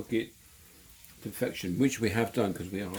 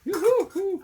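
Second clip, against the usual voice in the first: pained yelp; 12 dB louder, much slower than usual. Real, not acted. Burnt finger on hot scallop.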